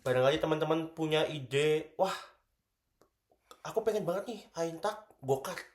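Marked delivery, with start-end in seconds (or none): none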